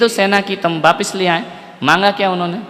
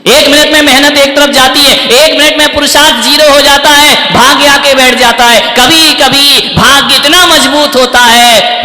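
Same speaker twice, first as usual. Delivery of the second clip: raised, louder, higher preacher's delivery; preaching at full force; 5 semitones higher, 7 dB louder than usual. Real, not acted. Preacher pressing Kaikeyi's scheme on the audience, full force. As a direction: drive the point home to the crowd.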